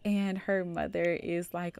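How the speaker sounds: ordinary talk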